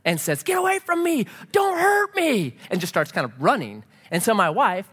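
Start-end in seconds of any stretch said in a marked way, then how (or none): 0.4-2.6 high-pitched mock panicked yell; imitated frightened yell. Pastor retelling a stranger's yell at him, imitated panic. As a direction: imitate a terrified woman warding someone off. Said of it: "She yells at me".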